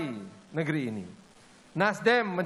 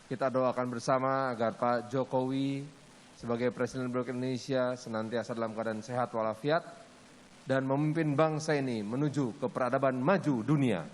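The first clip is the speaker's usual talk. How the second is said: prayer; 7 semitones lower, 4 dB quieter than usual. Acted. MC leads a closing prayer.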